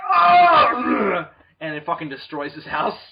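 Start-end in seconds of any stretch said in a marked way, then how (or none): 0.1-1.3 high, loud, drawn-out yell; hammed-up screaming roar; mock climactic scream. Superman at the final scene, mock scream. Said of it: "he's like".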